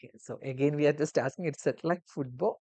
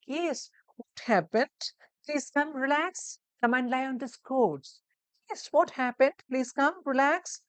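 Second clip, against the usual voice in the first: high, slow and soothing; caring nurse voice; 9 semitones higher, 3 dB louder than usual. Shown as a demonstration. Nurse welcoming patient onto the couch, soothing. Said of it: "That intonation you need to focus on".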